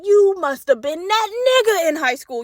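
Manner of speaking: high-pitched, impressed cheering on; mock-awed, high-pitched praise; admiring hype. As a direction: hype him up playfully. Hyping up an imagined husband.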